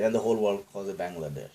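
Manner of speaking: usual speaking voice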